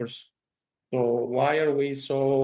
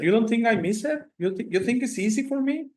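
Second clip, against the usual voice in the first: higher, indignant protest, retold; imitating her upset complaint; upset retort; 9 semitones higher than usual. Man retelling an upset guest's complaint, mock indignant. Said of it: "and she was upset".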